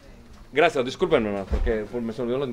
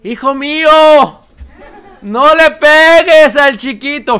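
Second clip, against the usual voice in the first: high, much louder slow cry of a mother; slow, loud motherly shout; 8 semitones higher, 16 dB louder, slower than usual. Retold or imitated. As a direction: yell it like a mother protecting the little one. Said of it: "y grita, como cualquier madre".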